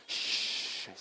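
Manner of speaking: short hushing "shh"; brief lulling shush; soothing shush. Retold or imitated. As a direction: hush a wriggling baby. Shushing the baby in retold story.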